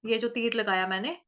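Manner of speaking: ordinary talk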